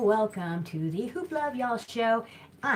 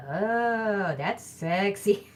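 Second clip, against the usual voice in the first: slow teasing that's sexy; drawn-out playful compliment, a bit louder; mock sultry, slowly; flirty remark; 2 semitones higher, 5 dB louder, much slower than usual. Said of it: "And I was like".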